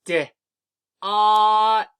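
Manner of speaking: D then ot, each sound held long and loud; D, then ot, sounded out slowly and loudly; sounding out words. Shown as a demonstration. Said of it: "Watch how I do it".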